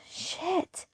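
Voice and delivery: breathless whispered shit; a startled whispered curse. Acted. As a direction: hiss it under your breath. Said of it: "I say".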